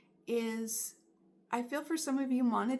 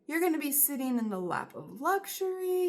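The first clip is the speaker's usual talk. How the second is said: higher, louder joyful cheer; excited cheer; 4 semitones higher, 7 dB louder than usual. Acted. Odonis cheering the viewer's victory, excited and loud. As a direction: burst out celebrating a win. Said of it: "Odonis says".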